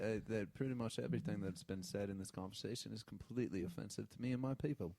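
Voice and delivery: put-on British accent. Offended listener speaking for his people, soft British accent. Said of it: "Are you British?".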